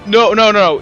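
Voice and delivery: ordinary talk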